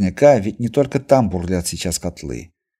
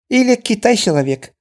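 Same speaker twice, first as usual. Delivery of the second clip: Chinese merchant voice; 13 semitones higher than usual. A narrator's reading. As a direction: say it eagerly.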